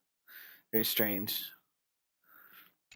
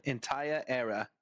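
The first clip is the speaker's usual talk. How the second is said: imitated British accent. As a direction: echo it with a British lilt. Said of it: "Did she turn British there".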